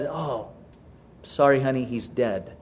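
low, hushed, hesitant husband; subdued bad news. Husband telling his wife the cat died, subdued. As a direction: break it softly and low, with pauses.

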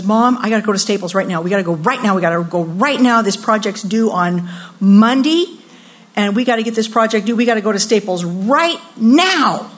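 fast, insistent rush of demands; urgent teen. Retold or imitated. Grandmother mimicking granddaughter begging mom for a Staples run, urgent. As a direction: imitate a teenager pestering mom in a hurry.

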